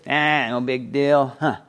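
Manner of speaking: slow, offhand brush-off; dismissive shrug. Acted. Guests shrugging off the king's invitation, dismissive. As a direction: wave it away like it's nothing. Voicing guests making light of it.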